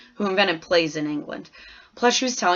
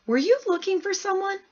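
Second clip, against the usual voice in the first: much higher, puzzled polite question; surprised teacher; 12 semitones higher than usual. A narrator's reading. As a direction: ask the odd visitor, baffled. Teacher asks the stranger.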